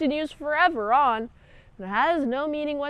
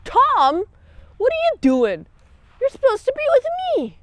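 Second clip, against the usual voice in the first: high, louder, fast pleading; hammed-up wife confronting him, much higher; imitating a desperate wife; 12 semitones higher, 7 dB louder than usual. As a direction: call him, rising to a wail. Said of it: "She's like".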